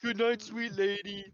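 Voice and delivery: high theatrical swooning farewell; hammed-up courtly farewell. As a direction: play it like a lovesick courtier. Player hams courtly farewell.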